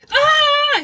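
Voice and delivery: high, loud scream of fright. Acted. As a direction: scream as bats burst out. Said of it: "he screamed".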